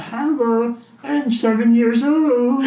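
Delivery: mock a grown-up putting on a kid voice; mocking a faked seven-year-old alter; child alter voice. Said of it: "And they're like".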